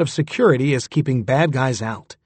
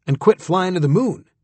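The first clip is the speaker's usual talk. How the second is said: insisting; 4 semitones higher than usual. Acted. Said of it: "he insisted".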